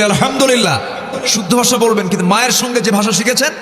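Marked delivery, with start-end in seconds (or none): 0.0-0.8 higher, louder Alhamdulillah; louder response. Shown as a demonstration. Preacher asking the crowd to say আলহামদুলিল্লাহ louder. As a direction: call it out so the crowd joins. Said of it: "জোরে বলি".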